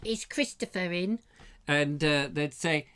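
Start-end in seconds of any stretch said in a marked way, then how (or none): 0.1-1.2 put-on high, small child at the door; child caller. Kid asking at a friend's door, high child voice. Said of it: "You had to go around and go".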